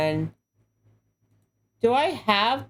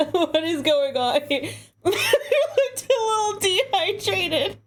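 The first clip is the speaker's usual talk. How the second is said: laughing, higher; 6 semitones higher than usual. Real, not acted. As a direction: laugh through the words. Laughing under spray.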